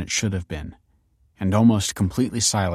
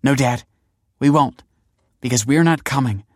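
raised, louder, resolute voice; firm refusal; 5 semitones higher, 5 dB louder than usual. A narrator's reading. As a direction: refuse him point by point. Daughter firmly refuses her father.

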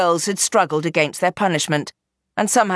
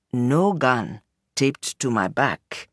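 slow, lower, deliberate reply; 5 semitones lower, slower than usual. Acted. Tunde showing the women his bare back, slow and deliberate. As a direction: let each word land slowly.

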